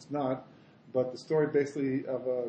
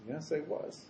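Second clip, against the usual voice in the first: much softer, understated reply; solemn confirmation; 11 dB quieter, slower than usual. Acted. Rebbe confirming to his Chassid, quiet and solemn. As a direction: answer quietly as the revered rabbi.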